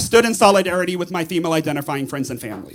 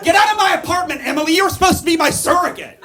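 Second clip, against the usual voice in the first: outraged yelling; 10 semitones higher than usual. Acted. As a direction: tear into her, half laughing.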